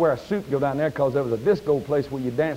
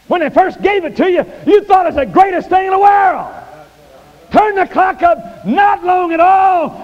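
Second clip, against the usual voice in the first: much higher, much louder, slow climax of the sermon; preaching at full force; 11 dB louder, slower than usual. Acted. Preacher roaring the climax to his congregation. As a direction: drag out each phrase, full revival fire.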